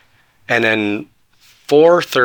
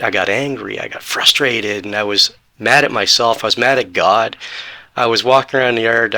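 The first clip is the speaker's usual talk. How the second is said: ordinary delivery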